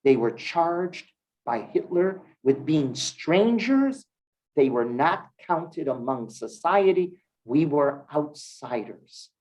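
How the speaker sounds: ordinary talk, nothing marked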